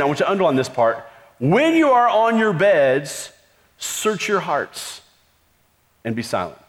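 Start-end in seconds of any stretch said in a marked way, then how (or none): 1.4-6.6 slow, fading reverent read; slow scripture reading. Shown as a demonstration. Preacher reading Psalm four to the church, slow reverent. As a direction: linger over each word of the verse. Reading verse slowly, hushed.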